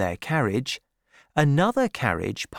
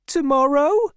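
high, louder eager question; eager young woman's high voice; hopeful girl; 6 dB louder than usual. A narrator's reading. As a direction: ask hopefully, pitched way up. Fanny asks hopefully.

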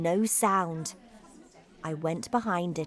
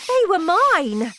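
high, loud astonished exclamation; shocked realisation; 10 semitones higher, 9 dB louder than usual. A narrator's reading. Boy discovers the paws are his, high shock. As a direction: burst out in shock.

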